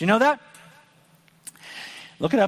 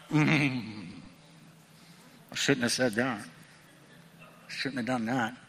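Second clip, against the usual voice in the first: low, soft, ashamed mumble; a small, embarrassed confession; sheepish regret; 6 semitones lower, 7 dB quieter than usual. Shown as a demonstration. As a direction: mutter it like a guilty kid caught out. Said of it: "it's gonna be like this".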